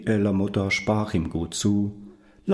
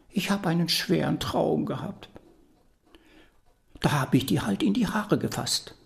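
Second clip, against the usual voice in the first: grandmother voice; 7 semitones higher than usual. Acted. Grandmother excuses herself.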